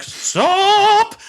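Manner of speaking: long, high, loud yell; mock shout. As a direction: cry out to halt a crowd. Acting Doctor running into crowd.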